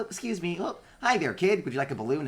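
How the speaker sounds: high chirpy robot. Acted. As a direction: offer cheerfully in a tiny robot voice. Robot offering balloon.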